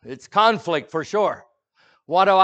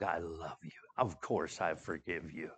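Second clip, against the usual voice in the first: gentle forgiveness; 9 semitones lower, 13 dB quieter than usual. Retold or imitated. Victor forgiving, hugging.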